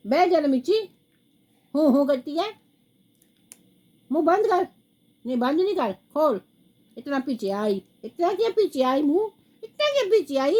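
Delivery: high, loud pet talk. Real, not acted. Grandma fussing at pet Mirchi, high playful scolding.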